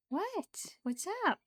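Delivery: high, swooping cooed question; high-pitched, playful pet talk. Real, not acted. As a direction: coo up high to a cat. Answering the cat's attention.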